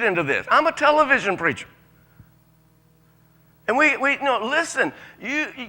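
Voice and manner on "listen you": ordinary talk, nothing marked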